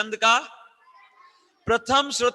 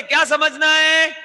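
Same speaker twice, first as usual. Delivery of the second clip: high, loud, drawn-out question; louder, rousing shout; 9 semitones higher, 10 dB louder, slower than usual. Shown as a demonstration. Teacher urging pupils to answer louder, rousing shout. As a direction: shout it out so the class answers at full voice. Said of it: "और जोर से".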